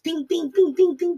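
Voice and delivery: high, playful pings off a helmet; light mock ricochet noises; ping sound effect. Helmet deflecting the enemy's attacks.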